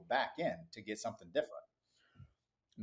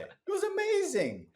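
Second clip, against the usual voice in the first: delighted; slower than usual. Real, not acted. Delighted about tax savings.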